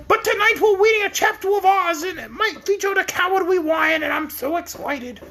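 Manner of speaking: high, trembling whiny yelps; Cowardly Lion voice. Pretend. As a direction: whine it like a scared lion.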